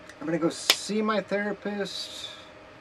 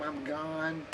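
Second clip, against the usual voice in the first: normal talking voice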